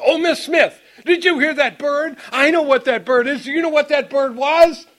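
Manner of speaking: high, loud, laughing eagerness; high-pitched excited chatter with a laugh; eager excited question. Retold or imitated. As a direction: burst out like a proud kid showing off. Eagerly telling neighbor about bird.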